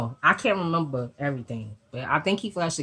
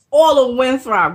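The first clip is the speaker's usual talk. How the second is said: All of Winthrop called out loud and high; emphatic; 8 semitones higher, 10 dB louder than usual. Retold or imitated. Stresses the threatened neighbourhood.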